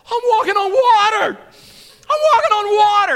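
high, loud, goofy amazement; loud, squeaky, wide-eyed wonder; Brian Regan. Retold or imitated. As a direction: shout it like a dopey comic marvelling. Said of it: "he turned into Brian Regan".

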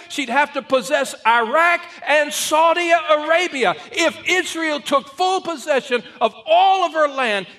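loud, high, fervent preaching; preaching at full force. Acted. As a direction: drive it home like a revival preacher.

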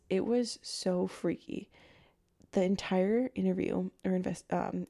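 ordinary talk, nothing marked

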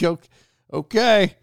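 startled high okay; surprised okay. Retold or imitated. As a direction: caught off guard, go up high. His startled reply.

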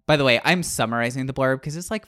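ordinary talk, nothing marked

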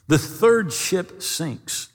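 slow dramatic beat. Acted. Dramatic retelling of shipwreck.

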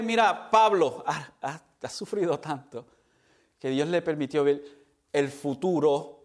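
ordinary talk

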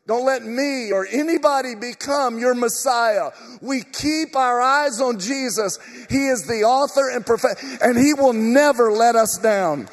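loud, rousing preacher building to applause; fired-up preaching. Real, not acted. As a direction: drive it home to the congregation at full force.

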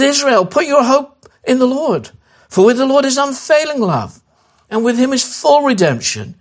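preaching at full force; proclaiming preacher. Acted. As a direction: proclaim it to a whole nation. Preacher proclaims psalm to Israel.

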